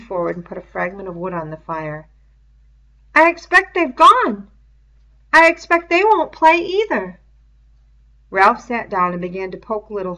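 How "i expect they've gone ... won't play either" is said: put-on higher, earnest boy's voice; fussy, clever boy explaining eagerly; higher, louder schoolboy, keen to suggest; Piggy's voice. Piggy's dialogue in a reading.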